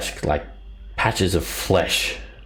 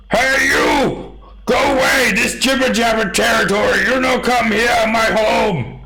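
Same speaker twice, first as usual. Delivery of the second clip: very high, loud, broken-speech giant yell; 5 dB louder than usual. Acted. Two-headed giant yelling at intruders to go away. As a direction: shout him off his turf. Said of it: "he just starts yelling".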